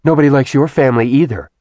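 quick, irritated jab; testy. A narrator's reading. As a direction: snap it back fast. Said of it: "Zarina said testily".

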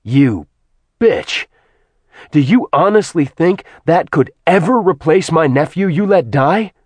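enraged man cursing the goddess; furious tirade. Acted. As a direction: spit hatred at her, full force. Acheron curses Artemis in rage.

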